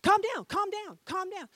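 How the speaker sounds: Holy Spirit calming. Retold or imitated. Preacher voicing the Spirit calming his temper, urgent. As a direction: soothe yourself hard in the heat of the moment. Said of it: "That's what I hear, you know, the Holy Spirit".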